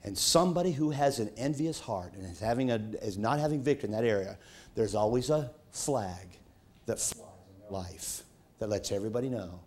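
ordinary talk, nothing marked

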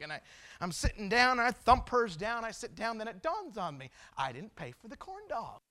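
usual speaking voice

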